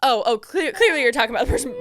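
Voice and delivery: laughing, flustered mock modesty; giggly stammer, playing shy; kinda shy. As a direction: act bashful through a giggle. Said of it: "kinda shy about it".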